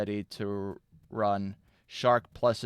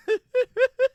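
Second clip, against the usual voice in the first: high squealing laugh; 6 dB louder than usual. Retold or imitated. Squeal after diva voice.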